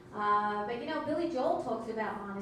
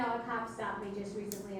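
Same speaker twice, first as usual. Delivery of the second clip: ordinary talk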